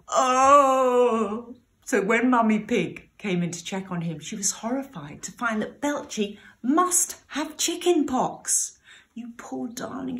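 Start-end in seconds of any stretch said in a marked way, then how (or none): 0.1-1.6 loud, higher put-on groan of pain; a naughty piglet hamming up a sick groan; fake groan. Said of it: "groaning loudly". 9.1-10.2 soft, higher tender mum voice; mother soothing her poorly piglet's brow. Acted. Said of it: "she cried as she soothed".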